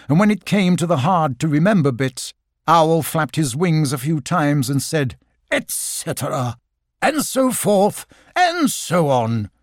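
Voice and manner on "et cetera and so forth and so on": slow, lofty and grand; grand Owl voice. Acted. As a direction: pronounce it like a pompous old owl. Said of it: "in such a grand way".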